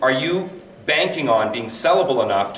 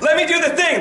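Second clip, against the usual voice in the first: much higher, eager and loud; bursting to have a go; excited; 13 semitones higher, 4 dB louder than usual. Retold or imitated. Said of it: "I was like".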